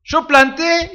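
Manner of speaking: a hint of Pablo disclaiming himself; voicing Pablo. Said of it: "dice Pablo".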